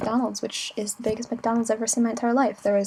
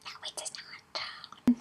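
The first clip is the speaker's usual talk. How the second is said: whispered disagreement; 8 dB quieter, much slower than usual. Retold or imitated. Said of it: "I was like".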